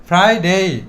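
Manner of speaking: ফ্রাইডে with a clearly present r; pronounced r. Shown as a demonstration. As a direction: keep the r in ফ্রাইডে and lengthen the end. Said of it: "আরটা উচ্চারিত হয়েছে". (ফ্রাইডে-তে "র" স্পষ্ট উচ্চারিত)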